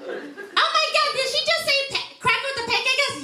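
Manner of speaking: hammed-up scandalised voice; mock shocked listener. Mock shocked audience member voice.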